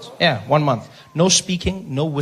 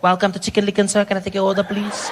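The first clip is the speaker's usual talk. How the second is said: higher, mock fast-food server voice; counter woman; 6 semitones higher than usual. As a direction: chant the welcome like a counter worker. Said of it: "The woman's there. She's like".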